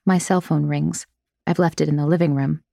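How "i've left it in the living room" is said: usual speaking voice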